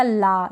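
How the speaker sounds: ला with an open "aa"; wrong aa vowel. Shown as a demonstration. Teacher showing a wrong reading, ला with "aa". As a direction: mispronounce law as ला, "laa". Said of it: "ये गलत हो जाएगा".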